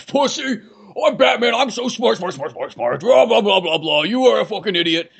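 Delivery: hammed-up high, ranting superhero; Batman. Cohost mimics the hero insulting everyone, hammed-up angry rant. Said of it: "it's always Batman's like".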